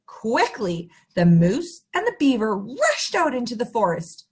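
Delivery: ordinary delivery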